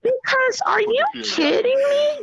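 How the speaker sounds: high, laughing incredulity; amused disbelief. Retold or imitated. Mother marvelling at son's boldness, high incredulous amusement. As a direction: pitch way up, half laughing at the nerve.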